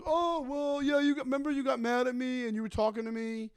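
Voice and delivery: a hint of a pestering guy; mimicking the heckler.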